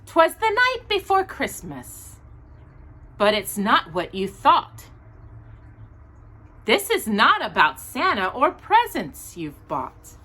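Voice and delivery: gentle rhyming storybook read; reading to children. Acted. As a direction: read the picture book aloud to little listeners. Reads a Christmas storybook aloud.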